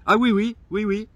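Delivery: high, loud sing-song "ah oui oui" taunt; sarcastic mock agreement. Mocking the driving school saleswoman, high and loud. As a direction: mimic her eager yes to ridicule it.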